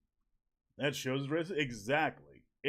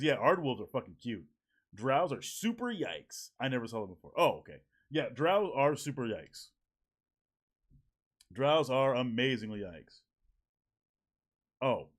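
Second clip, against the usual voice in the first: normal talking voice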